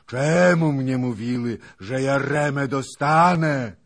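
high, very loud furious roar. A narrator's reading. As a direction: bellow in rage and despair. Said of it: "ryczał dalej hetman".

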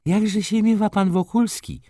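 high, loud, sugary prying question; sly gossiping shopkeeper. A narrator's reading. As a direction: fish for gossip with a sly smile. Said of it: "jej uśmiechów i półsłówek".

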